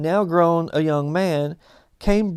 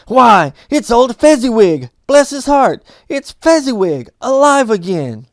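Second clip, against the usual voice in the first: much higher and louder, bursting with joy; great excitement; 6 semitones higher, 10 dB louder than usual. A narrator's reading. Scrooge recognizing his old master, excited cry. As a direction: shout it out like seeing a long-lost friend. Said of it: "Scrooge cried in great excitement".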